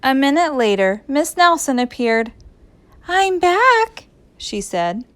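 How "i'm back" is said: high, sing-out announcement; high-pitched sweet teacher; returning teacher. A narrator's reading. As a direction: announce your return brightly.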